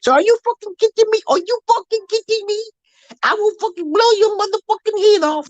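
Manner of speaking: very high, strained Italian gangster imitation; mock Italian accent. Ruthless Italian threatening someone who crossed him, mock Italian menace. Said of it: "That's how they talk".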